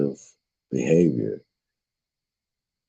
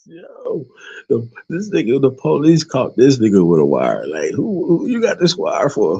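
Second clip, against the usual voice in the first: incredulous; 11 semitones higher than usual. Real, not acted. Reacting to the arrested spy, high incredulous questions. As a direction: pitch way up in amused outrage.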